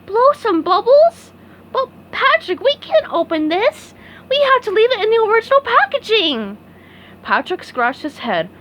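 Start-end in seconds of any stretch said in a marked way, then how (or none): 0.0-6.6 shocked. A narrator's reading. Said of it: "SpongeBob looked shocked".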